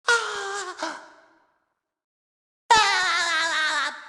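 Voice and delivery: high, drawn-out "ahh"; long wavering cartoon yell; cartoon cries. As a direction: cry out high and let it tail downward. Cartoon hero exclaims in game.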